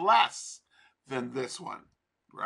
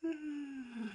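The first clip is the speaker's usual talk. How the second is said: growly sigh; 10 semitones higher, 13 dB quieter than usual. Real, not acted. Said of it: "I sound like a, a lion".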